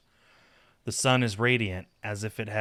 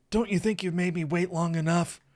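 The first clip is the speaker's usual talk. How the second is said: higher-pitched, teasing dare; defiant challenge; 9 semitones higher than usual. A narrator's reading. Saphir daring his lover to join him, defiant. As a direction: challenge your hesitant lover. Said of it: "looking defiantly at me".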